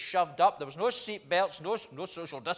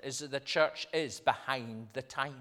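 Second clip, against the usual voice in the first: ordinary talk, nothing marked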